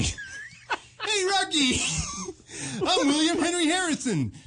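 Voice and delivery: high cartoonish character voice; William Henry Harrison. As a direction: pitch it up like a cartoon. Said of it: "I do a little William Henry".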